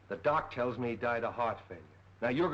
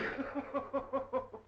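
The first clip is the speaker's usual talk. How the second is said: scoffing laugh; 13 semitones higher than usual. Acted. Detective scoffs at sanity report.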